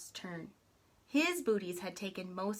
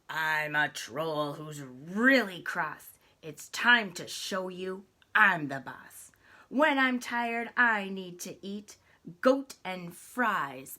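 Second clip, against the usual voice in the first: grumpy troll; 8 dB louder than usual. Acted. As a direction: bellow the rhyme like a sore-headed troll. Troll threatens big goat.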